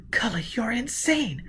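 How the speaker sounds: unvoiced shocked whisper. A narrator's reading. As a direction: hiss it in disbelief. Gisbella whispers.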